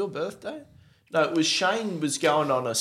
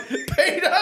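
high laughing call. Retold or imitated. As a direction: call his name laughing. Calling out to friend.

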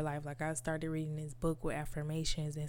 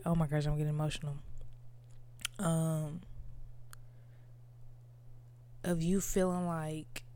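getting emotional. Real, not acted. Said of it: "I'm crying".

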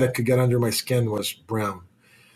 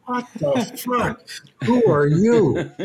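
mock disbelief; 10 semitones higher, slower than usual. His own retorted reaction.

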